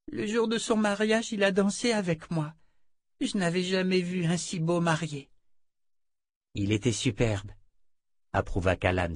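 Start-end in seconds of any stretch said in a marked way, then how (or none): 0.1-5.3 put-on a child fondly recalling a dance; wistful little girl. Oli recalls dancing with Warren, high wistful child.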